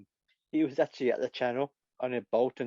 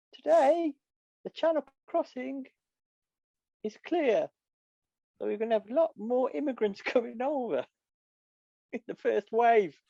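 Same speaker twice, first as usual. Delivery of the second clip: much higher, halting mock weather-lady voice; hammed-up high-pitched forecast presenter; 11 semitones higher than usual. Comic playing the weather lady beside Farage, in a high put-on voice. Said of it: "she could be the weather lady".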